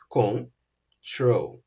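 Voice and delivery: "control" split slowly into con and trol; syllable by syllable. Shown as a demonstration. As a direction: say "control" in two separate beats, con, then trol. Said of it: "Eu tenho duas sílabas".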